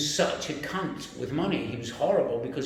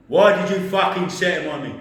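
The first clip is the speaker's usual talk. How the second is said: imitated boss, loud and raised; angry shout; 4 semitones higher, 9 dB louder than usual. Boss repeating his accusation to the employee, angry shout. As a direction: burst out much louder and higher.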